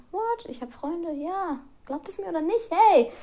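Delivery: high, excited fake disbelief; mock surprise. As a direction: act amazed at yourself. Mock surprise at having friends.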